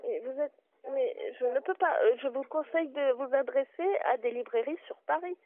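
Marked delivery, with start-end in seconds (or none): none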